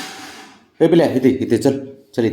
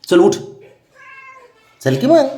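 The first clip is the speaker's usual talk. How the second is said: rising high-pitched urging; coaxing call. Real, not acted. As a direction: coax the lazy dog to get up. Urging the dog to get up.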